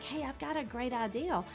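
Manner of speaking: ordinary talk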